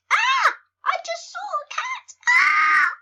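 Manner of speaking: very high, loud squealing fright; mock panicked scream. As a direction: shriek like a cartoon mouse seeing a cat. Mouse in game sees cat.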